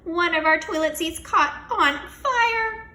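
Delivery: high, loud and slow alarm; frantic teacher announcing a fire; alarmed shout. Acted. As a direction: spell out the disaster loudly. Mister Klutz shouting alarm.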